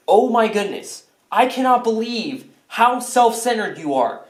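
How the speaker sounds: high-pitched, exasperated rant; indignant scolding. Acted. Scolding George for being self-centered, exasperated. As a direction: tell him off, pitch climbing with indignation.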